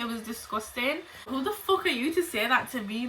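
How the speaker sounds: ordinary talk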